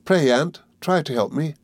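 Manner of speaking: high, imploring grief; agonised supplication. A narrator's reading. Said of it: "in its agony and grief".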